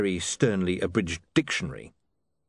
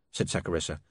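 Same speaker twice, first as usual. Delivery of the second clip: ordinary delivery, nothing marked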